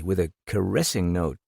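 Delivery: ordinary delivery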